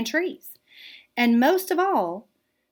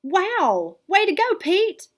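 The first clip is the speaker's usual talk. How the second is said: high, louder cheering praise; excited cheer; 10 semitones higher, 4 dB louder than usual. Acted. The gang praising Pete's drawing, excited cheer. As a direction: cheer him on.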